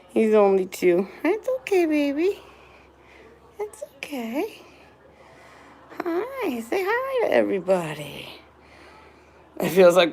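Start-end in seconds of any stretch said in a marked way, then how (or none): 1.2-8.3 high, soft reassuring coo; gently calm the pet down; soothing a scared dog. Real, not acted.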